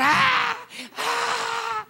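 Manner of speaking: very high, drawn-out mock scream; thin high-pitched cries, held back in volume; imitated scream. Injured man screams as onlookers stop, high imitated cries. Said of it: "el hombre empieza a gritar".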